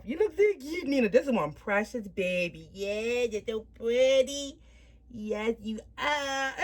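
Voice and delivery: real cooing, words stretched out. Real, not acted. Mother admiring her precious baby Nina, real baby talk. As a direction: coo adoringly in a lifted, lilting voice.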